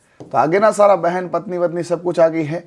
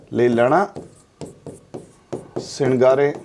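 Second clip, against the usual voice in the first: lower, each word set apart; slow dictation; 4 semitones lower, slower than usual. Real, not acted. Teacher repeats the name for note-taking, slow dictation. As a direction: leave a long pause between the words.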